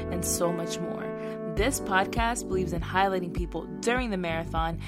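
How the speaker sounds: slow, polished intro read; podcast intro. Acted. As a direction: announce the show over music. Podcast intro read over music.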